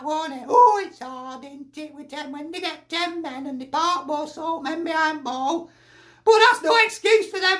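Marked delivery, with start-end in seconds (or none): 0.5-5.7 mocking Dash. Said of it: "I suppose Dash will say that".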